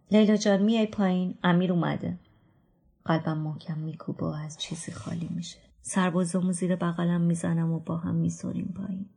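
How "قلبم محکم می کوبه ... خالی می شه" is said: soft unvoiced whisper; a hushed, frightened whisper; whispered dread. A narrator's reading. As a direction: breathe it out with a sinking heart. Heart pounding, narrator whispers.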